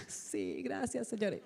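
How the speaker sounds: hushed, whispery little thank-you to God; gracias susurradas. Retold or imitated. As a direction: act out a private, relieved thanks. Retells her private delighted thanks. (agradecimiento susurrado, recreado)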